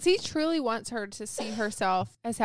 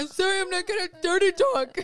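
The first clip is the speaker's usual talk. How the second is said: high, loud apology through laughter; mock pleading; 8 semitones higher, 5 dB louder than usual. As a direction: play a tearful sorry, cracking up.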